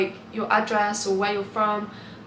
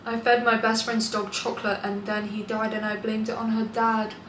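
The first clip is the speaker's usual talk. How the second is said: normal talking voice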